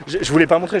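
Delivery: usual speaking voice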